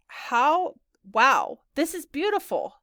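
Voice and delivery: high, slow, drawn-out wow; impressed awe. Acted. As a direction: gush over the fancy place. Guest awed by fancy apartment.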